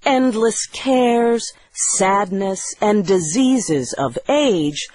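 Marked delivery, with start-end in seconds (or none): none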